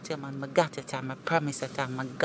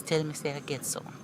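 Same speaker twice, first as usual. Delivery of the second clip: usual speaking voice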